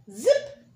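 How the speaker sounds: high, loud zip; zip sound effect. Acted. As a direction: snap out a quick zip. Tongue zipping into hole.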